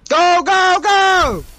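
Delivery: loud, high urgent shout; rally the team hard. Real, not acted. Urging team on.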